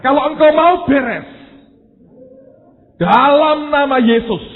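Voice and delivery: high, very loud, insistent appeal to God; bold shouted prayer. Acted. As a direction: cry out the prayer at full force. Acts out his bold urgent prayer. (doa berseru lantang, nada tinggi mendesak)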